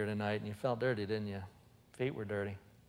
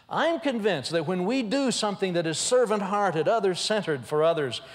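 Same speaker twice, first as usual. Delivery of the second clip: rising, louder preaching crescendo; 6 semitones higher, 6 dB louder than usual. Acted. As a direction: drive the point home from the pulpit. Preaching builds to a peak.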